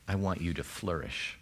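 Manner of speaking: tender god. Retold or imitated. Preacher voices God wishing the congregation well, tenderly. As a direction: say it like a father who wants good for you. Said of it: "maybe you hear".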